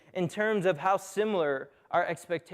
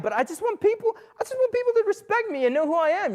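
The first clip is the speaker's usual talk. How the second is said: much higher, hammed-up plea; high-pitched pleading; 13 semitones higher, faster than usual. Preacher pleads with the audience for respect, in a high hammed-up voice. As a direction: squeak out a mock-defensive plea.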